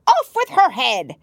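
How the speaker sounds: high furious shriek of a verdict; shouting Queen. A narrator's reading. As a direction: scream the sentence like a mad queen.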